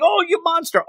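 high, yelling "oh you monster"; mock yelling. Bulma yelling at the strange boy, high mock yell. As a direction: squeal it out like a scared girl. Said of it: "But, like, yelling".

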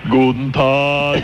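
put-on drawn-out German accent; [name]'s German voice. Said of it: "She hates [name]'s voice".

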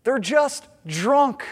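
slow, scornful mock of the mockers; they're just drunk, said slow with a sneering rise; mocking dismissal. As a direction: drag it out dismissively, rising at the end. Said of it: "their response is".

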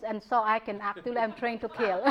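ordinary delivery

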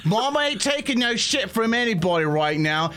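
high-pitched, no-nonsense matriarch; bossy kobold mama. Acted. Kobold mother ordering her horde to tables, bossy. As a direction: herd an unruly brood in a high voice.

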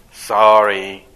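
low, loud, sullen one-word sorry; grudging sorry. Retold or imitated. Sulky child forced to apologize, low and sullen. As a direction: spit it out without meaning it. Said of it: "could you please say it better".

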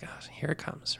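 half-whispered dread, under the breath; breathy whispered aside; muttered aside. Retold or imitated. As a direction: mutter it to yourself like bad news is coming. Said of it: "And he's like".

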